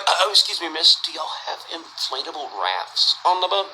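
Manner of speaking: low, hesitant mock-polite voice; timid question. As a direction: ask the flight attendant sheepishly. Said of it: "And he's like".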